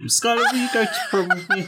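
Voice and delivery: fast, high, giggly prom ask; laughing high voice. Acted. Mort asks Skylar to prom in a high, laughing rush. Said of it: "That was the voice that he heard".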